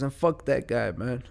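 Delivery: usual speaking voice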